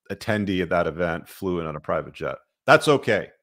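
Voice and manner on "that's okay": sarcastic. Real, not acted. Sarcastic mock approval.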